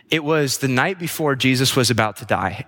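ordinary delivery

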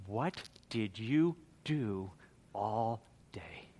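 soft, slow, near whisper; quiet voice of God. Acted. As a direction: ask it hushed and searching. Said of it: "he's gonna ask you".

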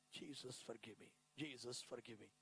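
low, soft plea for forgiveness; murmured retold prayer. Convert repeating his only prayer to Jesus, hushed and low. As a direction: pray it quietly, sinking low. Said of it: "the only prayer I prayed is".

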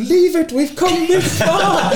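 very high, drawn-out hammed-up shout; comically strained high-pitched yelling, slowed down; retold desperate yell. Swimmer telling the crew not to cut the tree, mock desperate yell. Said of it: "like that".

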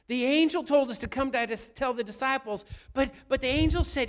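loud, ringing sermon voice; preaching at full force. Acted. Pastor preaching loudly to the congregation. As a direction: preach it out to the whole church.

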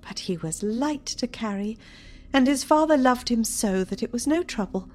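high, wistful mother's voice; sorrowful mother. Acted. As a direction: remember him fondly, then call out. Mother speaking in play.

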